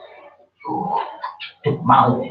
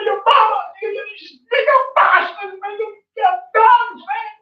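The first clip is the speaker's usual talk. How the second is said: fervent shouting sermon; 12 semitones higher than usual. Real, not acted. Man preaching to a young man about respecting his parents, fervent high shout. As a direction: shout it out like a street preacher, fired up.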